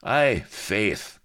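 lightly theatrical cry. A narrator's reading. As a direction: cry it out in agreement. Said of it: "cried Bilbo".

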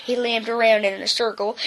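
usual speaking voice

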